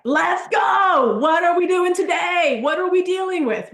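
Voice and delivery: loud, high pumped-up shout; hype shout. Shown as a demonstration. Greeting clients every day, energetic hype shout. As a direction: fire up your clients like a coach. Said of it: "I literally show up like this every day".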